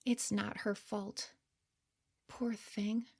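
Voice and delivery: hushed, gentle third-person commentary, half whispered; soft narrator aside. A narrator's reading. Narrator looking back on her younger self, soft and hushed. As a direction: speak softly over the scene like a caring observer.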